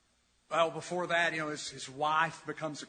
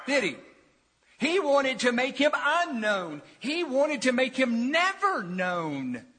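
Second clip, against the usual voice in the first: high, loud, emphatic pulpit delivery; forceful preaching; 11 semitones higher, 9 dB louder than usual. Real, not acted. Preacher tells congregation of Paul's hatred for Jesus, forceful preaching. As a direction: drive each point home to the congregation.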